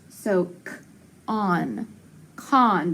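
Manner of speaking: "k", "on", then "con", each part said apart with a short O; teacher blending the sounds for the class; sounding out con. Shown as a demonstration. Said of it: "it's gonna be short".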